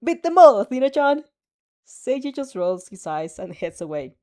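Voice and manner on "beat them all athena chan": very loud, high cheering yell; cheering shout. Acted. A boy shouting a cheer at the TV, very loud. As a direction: belt it at the screen.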